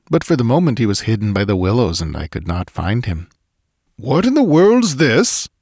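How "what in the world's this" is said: high, slow, drawn-out cry. A narrator's reading. Swede hidden by willows to companion, crying out. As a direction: call out the question in earnest. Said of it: "I heard him cry again".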